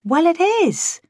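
high-pitched indignant comeback; injured insistence, voice lifted high; defensive protest. A narrator's reading.

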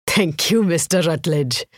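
put-on lilting, strong Indian accent; thick Indian accent. A narrator's reading. Winner thanking the casino manager, thick Indian accent. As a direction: give thanks in heavy Indian English. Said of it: "in a thick Indian accent".

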